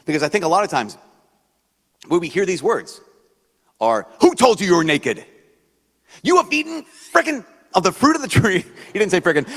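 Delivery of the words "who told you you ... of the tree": much higher and louder, a scolding rant; hammed-up furious father scolding a child; angry God. God scolding the naked man in the garden, a hammed-up angry shout. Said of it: "I think that's how most of us hear that".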